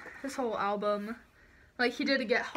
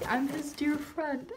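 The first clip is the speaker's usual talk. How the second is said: normal talking voice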